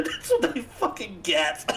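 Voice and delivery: laughing through the words. Real, not acted. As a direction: crack up while gloating. Laughing at the fart outcome.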